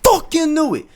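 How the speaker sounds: high, loud outburst; shouted inner thought. Retold or imitated. Catching the cheating girlfriend, a loud high I-knew-it shout. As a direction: yell it like a realization. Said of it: "in my head, I'm like".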